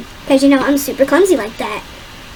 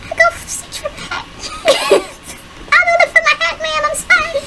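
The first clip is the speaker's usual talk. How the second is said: high whiny sobbing plea; faster than usual. Retold or imitated. Worker pleading with boss about hat, mock crying. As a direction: squeak out a teary apology to the boss. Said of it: "And I'm just like".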